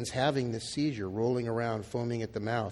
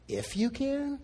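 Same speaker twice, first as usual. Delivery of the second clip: "If you can?" jumping high on CAN; incredulous questioning inflection; 9 semitones higher, 4 dB quieter, slower than usual. Acted. Jesus challenging the desperate dad, incredulous questioning inflection. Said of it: "I think the inflection was there".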